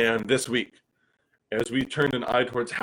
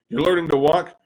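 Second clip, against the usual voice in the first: usual speaking voice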